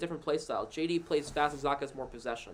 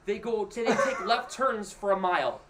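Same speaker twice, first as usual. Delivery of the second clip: slow, high, laughing through it; 5 semitones higher, 3 dB louder, much slower than usual. Real, not acted. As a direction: giggle while teasing. Laughing at nascar left turns.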